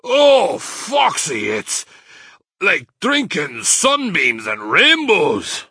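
gasped. Acted. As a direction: swoon over the taste. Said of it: "he gasped".

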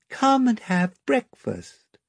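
high and slow, a gentle little lamb; a lamb invites the children to breakfast, sweet and slow; sweet milky lamb voice. A narrator's reading. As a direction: coax them kindly to breakfast, slow and light. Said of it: "in its sweet milky voice".

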